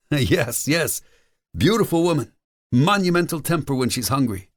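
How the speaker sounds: very high, jovial character voice; high-voiced, amused restaurateur; amused Mario. Mario laughs, agrees in character.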